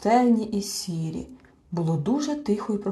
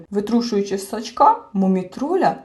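usual speaking voice

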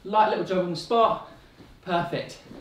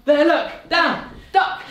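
higher and louder, urgent pretend warning; excited call; 7 semitones higher, 6 dB louder, slower than usual. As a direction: shout the dragon warning playfully. Calling out dragon game.

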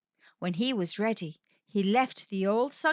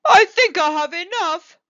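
pleased shark; 7 semitones higher, 12 dB louder than usual. Acted. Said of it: "said Shark".

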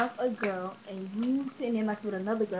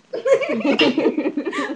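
loud laugh; 4 semitones higher, 10 dB louder than usual. Real, not acted. Laughs after sign-off.

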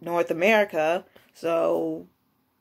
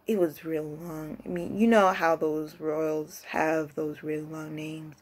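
usual speaking voice

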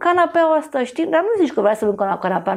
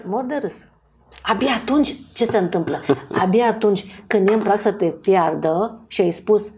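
normal talking voice

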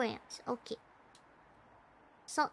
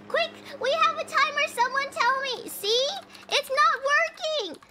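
high, flustered plea for help; urgent panic; 7 semitones higher than usual. Real, not acted. Player begging someone for the button, panicked. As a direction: call out urgently as the timer runs. Timer runs, controls fail.

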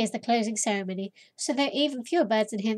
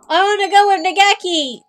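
higher and louder "I wanna go with Nageki!"; excited declaration; 8 semitones higher, 9 dB louder than usual. Real, not acted. Player eagerly choosing Nageki in the game, higher and louder. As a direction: announce it eagerly.

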